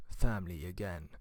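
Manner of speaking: a hint of a brooding action hero; mock movie-trailer voice. Joking about Fast and Furious family theme, lightly mock trailer voice.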